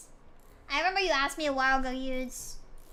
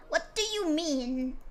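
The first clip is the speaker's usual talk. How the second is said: slow disbelief; much slower than usual. Acted. Repeats in disbelief.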